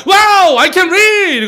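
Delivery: high, loud cry of amazement; acted excited shout. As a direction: shout it out like an amazed story character. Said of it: "이 얘기를 소리쳤다는 얘기입니다".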